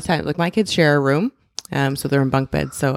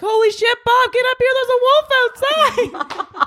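much higher, louder, slowed panicked cry; retold panic; 3 dB louder than usual. Mom calling husband upstairs about the wolf, panicked. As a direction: yell for help half laughing in fright. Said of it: "And I'm freaking out".

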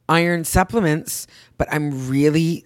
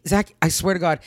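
ordinary delivery

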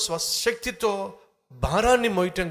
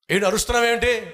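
raised, louder challenge; loud, higher-pitched retort; indignant lion; 6 semitones higher, 6 dB louder, faster than usual. Acted. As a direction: demand an answer as the lion. Startled lion challenges the shouting fox.

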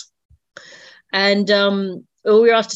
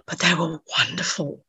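whispering; 5 dB quieter than usual. Real, not acted. Fond memory, voice drops to whisper.

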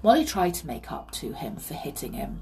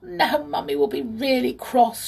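high, upset little complaint; tearful; 8 semitones higher, 5 dB louder than usual. A narrator's reading. Molly has missed her bus, mildly tearful. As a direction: lament it as an upset child. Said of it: "went back into the garden crying".

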